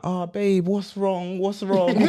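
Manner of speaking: soft, high-pitched cooing concern; mock concern. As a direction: comfort a crying partner, gently hammed up. Comforting his sobbing girlfriend, acted.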